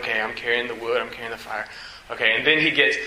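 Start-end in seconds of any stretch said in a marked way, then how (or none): 0.0-1.6 softer, dropping away; a hint of a dutiful boy; quiet Isaac. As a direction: mumble it along, unsuspecting. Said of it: "I was just like".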